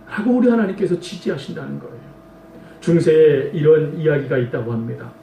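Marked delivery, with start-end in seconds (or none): none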